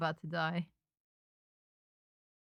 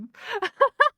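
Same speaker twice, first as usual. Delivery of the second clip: high loud burst of nervous laughter; 13 semitones higher, 5 dB louder than usual. Real, not acted. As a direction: laugh at your own panic. Laughing at own panic.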